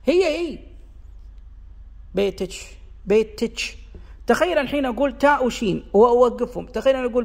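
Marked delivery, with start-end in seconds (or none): 2.2-3.8 بيتش with t-sh at the end; the kaf of بيتك turned into the ch of بيتش; kashkasha. Shown as a demonstration. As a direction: say بيتش ending in ch. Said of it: "فأصبحت كشكشة".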